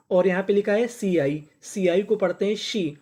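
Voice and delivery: usual speaking voice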